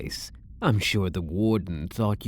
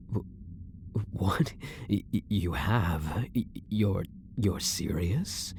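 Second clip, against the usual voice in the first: softer, stammering disbelief; stunned stammer; 6 dB quieter than usual. Acted. Prisoner stammering in shock at the guard's confession. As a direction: stumble over the words, caught off guard.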